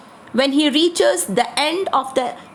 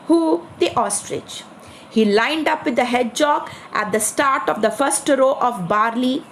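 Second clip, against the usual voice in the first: usual speaking voice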